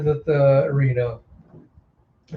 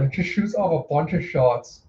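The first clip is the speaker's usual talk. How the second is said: ordinary delivery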